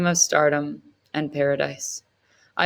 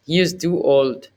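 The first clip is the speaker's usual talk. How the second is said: dismissive Setji voice; 3 dB louder than usual. Acted.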